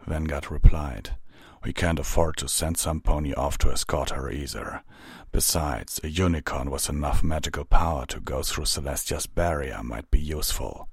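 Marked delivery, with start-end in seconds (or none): 1.6-10.9 low, restrained captain's voice; measured captain. Acted. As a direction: explain the decision in a low voice. Vanguard reasons in figure voice.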